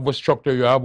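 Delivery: ordinary talk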